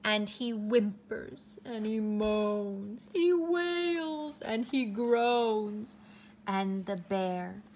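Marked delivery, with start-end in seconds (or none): 0.0-5.9 whimpering read. A narrator's reading. Bear's sorrow read.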